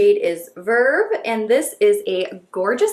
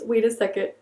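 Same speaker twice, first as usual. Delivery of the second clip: ordinary delivery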